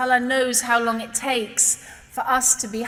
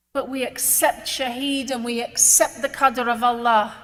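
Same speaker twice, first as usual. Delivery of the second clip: normal talking voice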